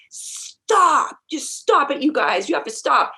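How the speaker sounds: retold yell to stop the food fight, half-acted; imitating a yell. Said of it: "you start yelling".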